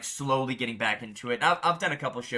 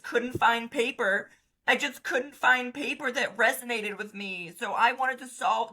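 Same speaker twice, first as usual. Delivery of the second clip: higher, earnest fake-sincere founder pitch; mock founder voice; 7 semitones higher than usual. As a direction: parody a sappy brand origin story. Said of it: "People are starting companies that's like".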